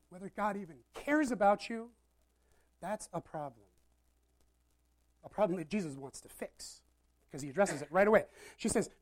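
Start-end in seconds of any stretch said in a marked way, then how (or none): none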